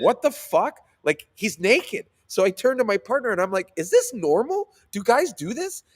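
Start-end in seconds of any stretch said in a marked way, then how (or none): 0.0-0.8 high, loud retold "what the hell" reaction; acting out stunned astonishment; shocked disbelief. 3.8-5.8 high, incredulous retold question; acting out a scandalized newcomer; baffled disbelief. Acts out baffled question to partner.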